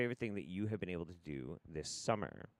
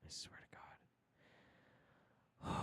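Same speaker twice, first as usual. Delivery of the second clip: whispered oath under the breath; voiceless, worn-out exasperation; exasperated whisper; 20 dB quieter, faster than usual. Real, not acted. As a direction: whisper it, at wits' end. Exasperated muttering at broken audio.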